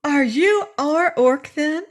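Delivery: put-on astonished girl insisting; amazed. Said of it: "demanded the girl, much amazed".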